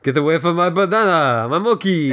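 hammed-up fake French accent; mock the guard with a terrible French accent; bad French accent. Co-host imitating the zoo guard, bad French accent. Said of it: "he has a really good French accent".

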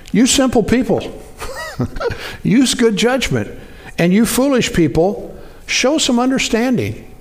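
high, lifted scripture reading. Acted. Pastor reading Proverbs aloud to the congregation, lifted and emphatic. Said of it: "where it says".

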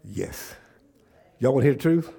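loud, high, fired-up preaching; full-force preaching. Real, not acted. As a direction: preach it hard to the crowd, almost shouting.

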